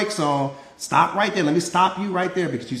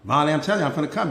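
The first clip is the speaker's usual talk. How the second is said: ordinary talk, nothing marked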